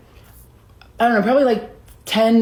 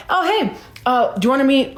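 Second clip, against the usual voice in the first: higher-pitched friendly greeting; casual, high-pitched "oh hey"; breezy hail; 7 semitones higher than usual. Retold or imitated. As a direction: call out to someone passing by. Voicing manager hailing a DJ.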